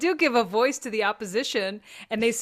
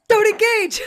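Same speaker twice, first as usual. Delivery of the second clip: much higher, much louder snap of a wife; imitating wife; 11 semitones higher, 10 dB louder than usual. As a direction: play the wife scolding, loud and high. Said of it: "That's what my wife says".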